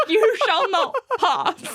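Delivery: high, slow, loud mock wizard decree; Gandalf. Mother blocking Santa's caravan, Gandalf-style slow loud decree. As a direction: boom it out like Gandalf blocking the bridge. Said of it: "Like Gandalf".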